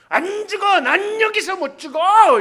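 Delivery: loud, high refusal to die; a shouted stubborn vow; defiant shout. Pretend. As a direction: dig in and refuse. Jacob refusing to give in.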